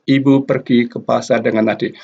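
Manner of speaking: quick, even syllables; each syllable the same short beat; fast syllable-timed read. Shown as a demonstration. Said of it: "length of the syllables here more or less the same".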